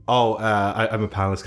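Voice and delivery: usual speaking voice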